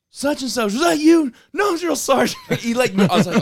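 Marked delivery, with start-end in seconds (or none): 0.1-1.3 high, loud barking sergeant; drill sergeant bark. Retold or imitated. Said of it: "He's all". 1.5-2.4 yes sir military replies. Retold or imitated. Recruit answering sergeant.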